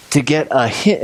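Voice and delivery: ordinary delivery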